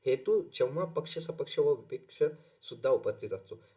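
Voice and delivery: normal talking voice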